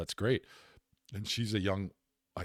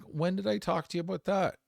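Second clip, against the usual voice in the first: high, louder disbelieving question; incredulous; 13 semitones higher, 6 dB louder than usual. Retold or imitated. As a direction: act baffled at what mom claims. Retold confused reply to mom.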